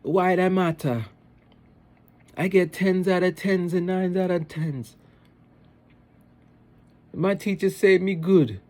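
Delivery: high, loud mock Jamaican kid; sassy Jamaican boy. Little Jamaican boy defending his marks to mom, imitated sass. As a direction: talk back in an exaggerated Jamaican child's voice. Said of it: "there's a lot of sass".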